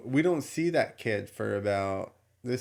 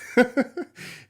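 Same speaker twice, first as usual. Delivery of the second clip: high burst of laughter; 6 dB louder than usual. Real, not acted. Laughing at the kid.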